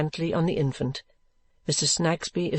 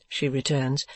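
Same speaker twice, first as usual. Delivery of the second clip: ordinary delivery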